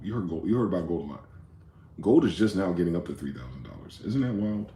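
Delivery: usual speaking voice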